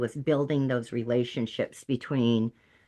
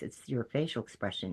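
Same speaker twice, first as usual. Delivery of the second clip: usual speaking voice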